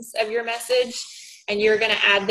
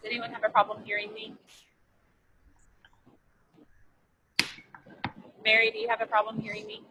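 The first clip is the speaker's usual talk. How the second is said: echoing tunnel; 10 dB quieter, much faster than usual. Real, not acted. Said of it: "You sound kinda like you're in a tunnel".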